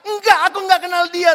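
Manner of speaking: high, loud and fast, brushing the accuser off; loud hasty denial. Retold or imitated. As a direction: blurt out the denial in a panic. Peter denies when accused.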